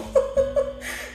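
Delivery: breathy high giggle; a quick airless laugh up high; high airy laugh. Real, not acted. Sister laughing about her stingy brother, airy high giggle.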